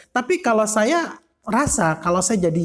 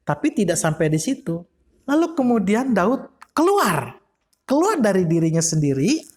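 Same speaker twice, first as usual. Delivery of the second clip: normal talking voice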